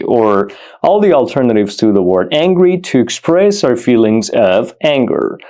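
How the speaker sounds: ordinary delivery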